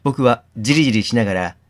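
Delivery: steady reading of the inner monologue; story narration. A narrator's reading. Streamer reading a visual novel aloud to viewers, narrating. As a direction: read the game text like a novel.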